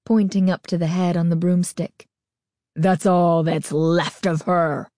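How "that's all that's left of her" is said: low slow menacing growl; a masked girl growling at her friends. A narrator's reading. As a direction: growl it out to scare them. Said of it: "she growled".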